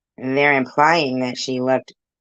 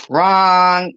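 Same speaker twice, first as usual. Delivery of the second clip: loud, raised-pitch rebuttal; emphatic denial; 5 semitones higher, 7 dB louder than usual. Real, not acted. Caller rejecting a false accusation, emphatic. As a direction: throw it out forcefully, loud and higher.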